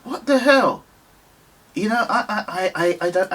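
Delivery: usual speaking voice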